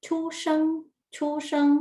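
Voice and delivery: "ชู่เซิง" held high and even, clearly separated; pronunciation drill. Shown as a demonstration. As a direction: model "ชู่เซิง" in a high, careful teacher voice. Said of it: "ทวนการออกเสียงอีกครั้งหนึ่ง".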